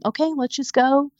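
a hint of her calm husband saying it's fine; mock-calm husband reassuring her on the way out; imitating calm husband. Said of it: "he was so calm".

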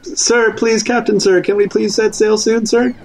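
high, deferential begging; pleading. Acted. Scolded passenger begs captain to set sail, pleading. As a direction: beg meekly, playing it up.

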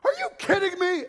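high, loud disbelief; incredulous. Retold or imitated. Crowd reacting to Jesus, high incredulous outburst. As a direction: scoff like you cannot believe it. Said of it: "everyone went".

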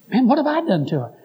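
mildly baffled protest. Retold or imitated. Preacher asking associate about the daughter's upset, retold bafflement. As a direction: protest innocence, acting it out.

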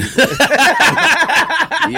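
high loud burst of laughter. Real, not acted. Friend laughs hard at the Wex joke, loud laugh. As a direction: let a big laugh burst out.

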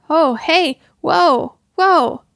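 loud, high startled cries; alarmed whoa. A narrator's reading. Protester lifted off mid-speech, startled loud cries. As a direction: cry out as the ground drops away. Og suddenly starts floating away.

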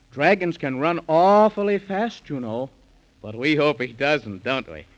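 ordinary delivery

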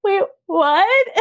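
high, loud, half-laughing half-crying "wait, what"; gasping it out in shock; stunned disbelief. Retold or imitated. Told she booked the job, stunned and high. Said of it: "I remember being like".

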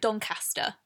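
Doncaster as DON-cass-tuh; short-A Doncaster. Shown as a demonstration. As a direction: say Doncaster with the A of cat. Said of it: "I say in my head Doncaster".

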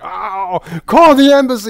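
mock panic. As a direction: cry out for help in a hammed-up panic. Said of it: "it's like".

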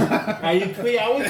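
"twee" said with a w for three, slow and laughing; mocking lisp.

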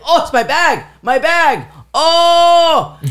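very high, overjoyed cries in imitated African accent; high, jubilant exclaiming; joyous African man. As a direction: play an African man thrilled to recover his bag. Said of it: "He had an African accent".

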